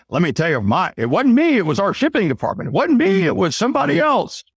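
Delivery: high, whiny excuse-making; mock blame-shifting. Coach mocking a rep blaming shipping, high and whiny. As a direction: play the rep passing the buck. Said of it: "Instead of calling and saying".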